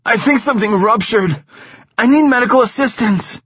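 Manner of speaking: high, loud, strained pleading; faked pain. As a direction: beg for help through fake pain. Sid faking illness.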